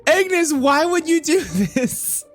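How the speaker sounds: high, slow, laughing shrieks; high, shrieking laughter at the screen. Real, not acted. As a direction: squeal through the laughter in shock. Shrieks laughing at a nude sim.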